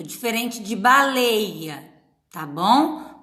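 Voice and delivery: normal talking voice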